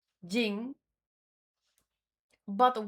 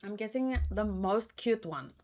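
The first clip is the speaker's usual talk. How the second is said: ordinary talk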